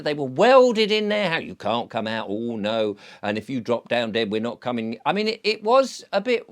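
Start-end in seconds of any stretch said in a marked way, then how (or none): none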